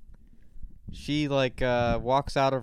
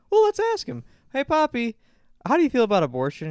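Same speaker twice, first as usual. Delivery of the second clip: high, loud and fast mock Jerry; imitating Jerry; 11 semitones higher, 5 dB louder than usual. Jerry baiting Poppy in the restaurant, high loud mock glee. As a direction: chirp it out, stirring up trouble on purpose. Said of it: "It's like".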